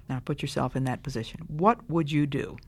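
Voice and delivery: ordinary talk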